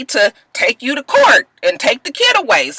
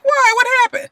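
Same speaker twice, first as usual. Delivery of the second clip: Shonda worried; 13 semitones higher than usual. Retold or imitated. Wife asking Screwface what happened, high and worried. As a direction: ask anxiously. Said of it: "And she was like".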